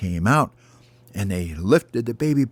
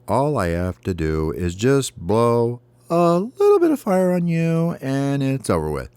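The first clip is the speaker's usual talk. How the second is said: swooping, mildly mocking dragon threat; lightly voiced taunting dragon; 5 semitones higher than usual. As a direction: drag it out as a hint of a boastful dragon. Dragon taunts the baby bird.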